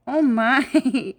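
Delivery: normal talking voice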